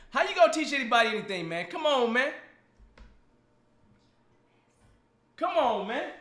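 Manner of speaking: fake-frustrated ribbing of a guest; mock exasperation. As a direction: playfully chide a friend. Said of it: "I'm just teasing him".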